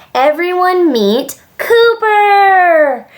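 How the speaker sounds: high, loud, drawn-out introduction; thrilled child announcing with a flourish; excited announcement. Acted. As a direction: present the star with a long triumphant cry. Child proudly presents his dog.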